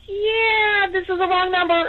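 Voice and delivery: very high, held-out "yeah" of nervous refusal; uneasy high-pitched brush-off. Retold or imitated. Caller hearing creepy whispers backs out, uneasy and high.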